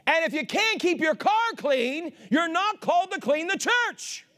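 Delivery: full-force preaching. Real, not acted. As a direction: punch each phrase out to the congregation.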